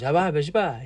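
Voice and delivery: playful fake-language chatter; mock Armenian gibberish. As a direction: imitate a seller speaking Armenian to his friend. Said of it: "I think he was speaking Armenian".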